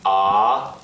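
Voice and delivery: rising-pitch "ah"; second tone. Shown as a demonstration. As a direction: slide "ah" upward. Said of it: "start your pitch a bit lower and then raise it up".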